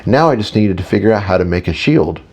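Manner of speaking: ordinary delivery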